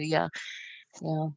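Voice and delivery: ordinary talk, nothing marked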